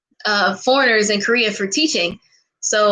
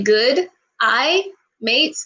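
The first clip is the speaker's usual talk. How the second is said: a shaky try at Australian, "Good eye, mate" with rising tune; attempted Australian accent; much slower than usual. Shown as a demonstration. Vlogger attempting her idol's Australian accent, rising. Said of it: "I cannot do an Australian accent".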